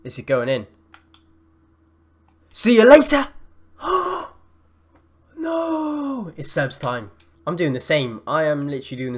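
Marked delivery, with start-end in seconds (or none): 2.5-4.3 high, very loud send-off; triumphant shout. Real, not acted. Gamer scoring past his opponent, triumphant shout. Celebrates shot going in. 5.4-6.3 long high wail; anguished "no". Real, not acted. Gamer watching it go wrong, anguished cry. Dismay as play fails.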